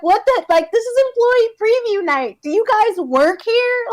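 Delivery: high, disbelieving outburst; incredulous. Retold or imitated. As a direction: sound baffled that anyone would do this. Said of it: "And I was like".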